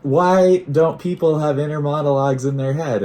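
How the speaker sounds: very high, loud mock panic; hammed-up impression of a panicking partner; imitating freak-out. Man mimicking his partner's reaction on camera, mock freak-out. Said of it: "She was freaking out".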